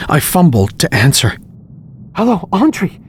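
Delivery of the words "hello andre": hushed, panicked greeting; panicked whisper. A narrator's reading. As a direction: whisper it in a panic, scared to be heard. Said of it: "in a panicked, whispered voice".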